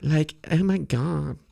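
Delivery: high mocking valley-girl gasp; hammed-up high valley-girl voice. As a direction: squeal it like a gossipy teen. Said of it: "every other word is "like".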